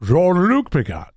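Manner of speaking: grave, theatrical character impression; Picard. Shown as a demonstration. Announcing himself in an impression for his co-host, put-on captain voice. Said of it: "See if I can do the impression".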